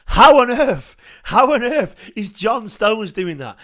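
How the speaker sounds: high, loud cry of disbelief; incredulous shout. Real, not acted. Fan yelling in disbelief at John Stones' dribbling.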